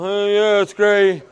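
half-hearted mock pleasantness; fake cheer. Preacher acts tired churchgoer at church, feigned cheer. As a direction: say it through a pasted-on smile. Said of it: "we put on this cockeyed smile".

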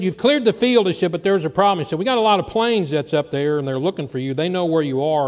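loud, high preacher's climax; preaching at full force. Acted. Preacher telling the church about the rescue, full-force preaching. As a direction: ring it out to the congregation.

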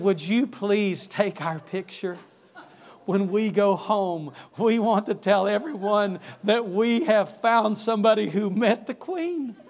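slow, emphatic mock gushing; excited tourists. Tourists ask the man who met the Queen for a photo, lightly gushing.

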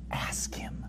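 breathy whispered aside. Acted. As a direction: whisper it like a secret to the congregation. Preacher whispers the answer.

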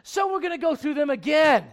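loud, high preacher's drive; full-force preaching. Acted. Pastor pressing the church, loud, high full-force preaching. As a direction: preach it hard to the congregation.

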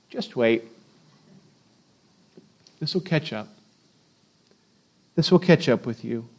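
low hushed voice of a wise elder; quiet knowing warning. Retold or imitated. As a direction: warn gently, like an old sage.

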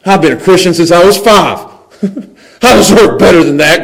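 hammed-up entitled complaint; grumbling complaint. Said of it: "Grumble, grumble".

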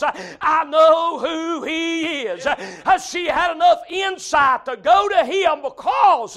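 driving, rhythmic pulpit delivery; full-force preaching. Acted. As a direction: preach it hard with punched key words.

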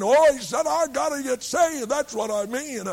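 high, emphatic outburst with a loud "Oh"; urgent insistence. Retold or imitated. Husband answers what he means, urgent and high. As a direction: insist on it with desperate force. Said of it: "Why did you say it like that?".

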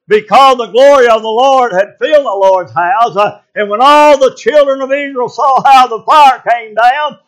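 loud, high preacher's cadence at full force; preaching. Acted. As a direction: preach it out to the congregation.

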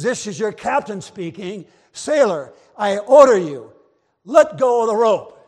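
building from firm to a full loud command; escalating order. Acted. Captain bellows an order at the frozen sailor, building to a shout. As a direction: start firm and build to a roar.

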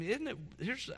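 normal talking voice

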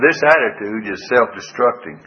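normal talking voice

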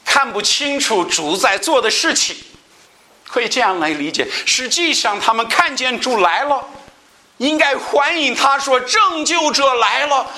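high, intense sermon voice; impassioned pulpit delivery; fervent preaching. Acted. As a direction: preach it out with rising fervor. Preaching at raised pitch.

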